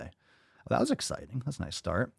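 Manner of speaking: usual speaking voice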